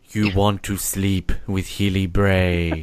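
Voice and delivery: slow, drawn-out suggestion; hypnotic voiceover. Retold or imitated. Host parodies the hypnosis tape for a Bond joke. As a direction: plant the thought slowly. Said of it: "change the audio and be like".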